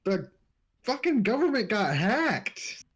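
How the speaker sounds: outraged. Real, not acted. Outraged news delivery.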